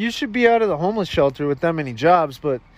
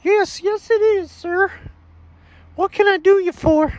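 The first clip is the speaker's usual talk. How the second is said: very high, squeaky customer-service voice; high cartoon shop assistant; shop clerk character. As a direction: pitch it way up as an eager clerk. Plays the auto shop clerk.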